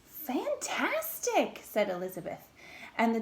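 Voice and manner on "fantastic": high, slow, drawn-out wonder; amazed praise. Acted. Princess praising the dragon, slow mock amazement. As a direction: gush over the dragon.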